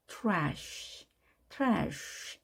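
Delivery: Trash ending on a long sh; drawn-out sh. Shown as a demonstration. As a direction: stretch the sh of trash like a hush. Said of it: "소리도 늘어지는 겁니다".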